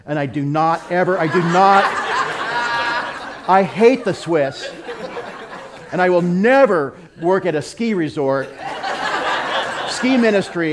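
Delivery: loud, laughing pretend prayer; laughing mock prayer. Joking to the congregation about his Swiss mission prayer, loud and laughing. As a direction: pray it loud, breaking into laughs. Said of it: "So now my prayer is".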